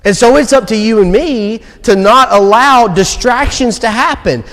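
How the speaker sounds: preaching to the congregation, urging them to act; preaching at full force. Acted. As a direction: challenge the church with urgency. Final exhortation to the church.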